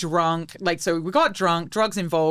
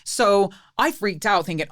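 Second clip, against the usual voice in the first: usual speaking voice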